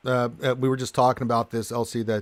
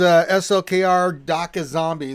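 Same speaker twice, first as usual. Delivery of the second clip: normal talking voice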